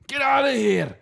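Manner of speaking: high, loud hammed-up Scottish shout; mock Scottish shopkeeper. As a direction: bark him out of the shop in a put-on Scottish accent.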